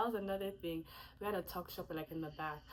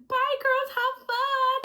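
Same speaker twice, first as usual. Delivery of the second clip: much higher, louder chirpy farewell; imitating Auntie's goodbye; 11 dB louder, much slower than usual. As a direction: wave them off brightly, high and loud. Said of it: "and then she was like".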